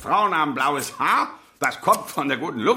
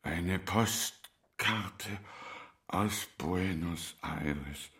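soft, slow and low, drawing him in close; old tailor beckons the sailor in a hushed voice; hushed secret; 9 semitones lower, 11 dB quieter, slower than usual. Acted. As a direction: murmur it like sharing a secret. Tailor turns secretive, softly.

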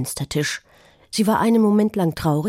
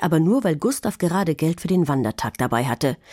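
plain reading voice

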